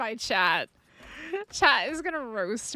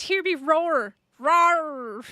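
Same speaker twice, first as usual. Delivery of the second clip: playful pretend beast roar, only a little louder; mock roar; 2 semitones higher, 4 dB louder, much slower than usual. Streamer protesting she's no kitten, playful mock roar. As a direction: ham up a tiny feisty beast roaring. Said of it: "I'm not a kitten. I'm a big feisty beast".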